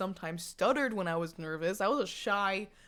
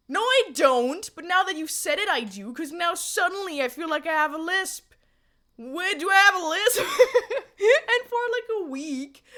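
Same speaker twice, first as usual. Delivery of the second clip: loud, high indignant protest; excited outburst; 9 semitones higher, 9 dB louder than usual. Real, not acted. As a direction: protest it, all fired up.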